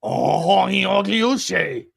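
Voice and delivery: Agliouche in a hammed-up French accent, "ah-glee-oo-SHAY". Shown as a demonstration. Asked by his co-host, he says the name in a French accent. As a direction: play a Frenchman saying Agliouche. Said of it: "Can you say that in French accent?".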